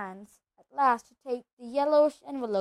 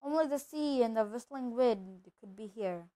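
ordinary delivery, nothing marked

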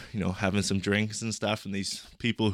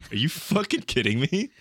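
higher-pitched mock outrage; indignant disbelief; 6 semitones higher than usual. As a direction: retell it as an incredulous protest. Retold disbelief at a misunderstanding.

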